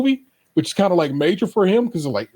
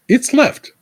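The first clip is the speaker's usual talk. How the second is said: It's left said higher and louder in disbelief; surprised reread; 8 semitones higher, 4 dB louder than usual. Shown as a demonstration.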